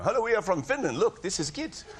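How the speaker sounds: high mock Finnish accent; Finnish fan. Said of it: "You know".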